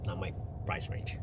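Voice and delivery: usual speaking voice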